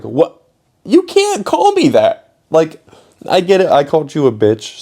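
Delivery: can't believe a teacher said that; indignant disbelief. Retold or imitated. Sixth grader indignant at the teacher's insult, disbelieving. Indignant at being called asshole.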